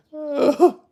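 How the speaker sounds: a sudden, high burst of loud laughter. Real, not acted. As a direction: crack up loudly and high. Laughs at the idea.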